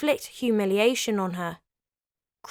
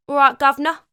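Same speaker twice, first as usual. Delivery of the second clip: loud, cheeky Cockney guard; a hammed-up Cockney "governor"; Cockney accent; 3 semitones higher, 7 dB louder, faster than usual. Kingston the jailer answers Anne, in a loud mock Cockney accent. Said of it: "Don't know if Kingston had a Cockney accent".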